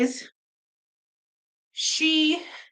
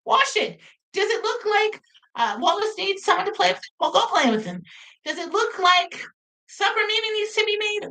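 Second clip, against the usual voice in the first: exasperated; 11 semitones higher than usual. Retold or imitated. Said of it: "I said".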